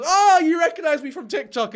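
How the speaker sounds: high, loud mocking impression of her; smug mock voice. Streamer mocking her vanity, smug mock voice. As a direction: sneer it out like a vain influencer.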